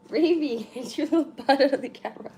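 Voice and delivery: high, loud call to the pet; calling the cat. Real, not acted. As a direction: call the cat off, half laughing.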